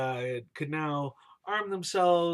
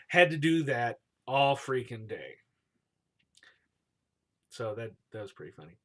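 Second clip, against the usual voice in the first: normal talking voice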